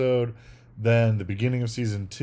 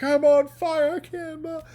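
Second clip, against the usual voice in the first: much higher, crying mock agony; overacted cry of pain. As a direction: wail it like a terrible actor on fire. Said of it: "He's rolling on the ground".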